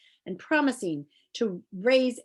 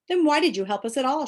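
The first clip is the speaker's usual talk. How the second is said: higher, louder mild shout; shouted question; 6 semitones higher, 6 dB louder than usual. Acted. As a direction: shout it back in dismay. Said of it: "shouted Milo".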